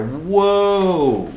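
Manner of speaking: high, loud gleeful whoa; excited whoa. Real, not acted. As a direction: cry out with delight while spinning. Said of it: "I'm spinning in a chair".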